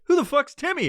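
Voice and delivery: high-pitched comic disbelief; baffled retort. Retold or imitated. Imaginary friend questioning the stoner, baffled. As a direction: squeak it back, baffled.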